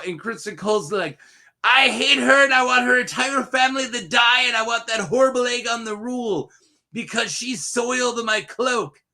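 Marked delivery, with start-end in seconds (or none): 1.6-9.0 imitated angry rant. Knight raging over his soiled cloak, mock furious rant. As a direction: rant like an aggrieved knight, hammed up. Acting out Cole's bitter rant.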